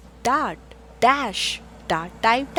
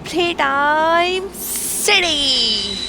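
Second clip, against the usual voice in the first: slow, loud, higher playtime call; drawn-out cheer; 5 semitones higher, 7 dB louder, much slower than usual. A narrator's reading. Reading a picture book aloud, a slow loud playtime call. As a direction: stretch it out like calling kids to play.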